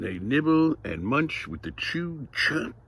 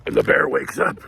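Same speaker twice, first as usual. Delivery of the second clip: bear gnarl; 3 semitones lower, 7 dB louder, much slower than usual. Shown as a demonstration. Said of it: "Can you guys gnarl?".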